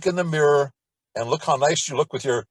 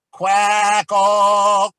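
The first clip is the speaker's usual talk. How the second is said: obnoxious piercing quack; 6 semitones higher, 9 dB louder than usual. Shown as a demonstration. Teacher demonstrating the obnoxious quack drill, piercing and loud. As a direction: Quack ah much higher and louder, piercing. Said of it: "it sounds obnoxious".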